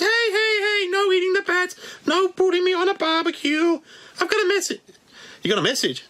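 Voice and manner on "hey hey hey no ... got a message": very high squeaky puppet bat; Wuhan bat voice. Pretend. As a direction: squeak out hellos like a tiny bat. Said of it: "The Wuhan bat".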